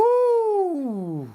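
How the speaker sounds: a high, loud, drawn-out ooh; surprised ooh. Real, not acted. Host reacts to a cutting comment, high drawn-out ooh.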